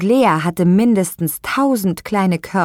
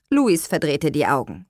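usual speaking voice

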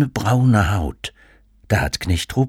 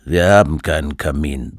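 low, grumbled reply; grumbling father; 5 semitones lower than usual. A narrator's reading. Father to child from behind his newspaper, low grumble. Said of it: "brummte Vater hinter seiner Zeitung".

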